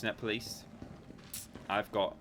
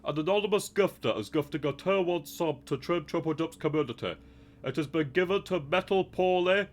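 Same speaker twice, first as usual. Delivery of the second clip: announcer voice gone astray; 4 semitones higher than usual. Acted. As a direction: put on a hammed-up show announcer voice. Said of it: "it was originally the announcer from the X Factor".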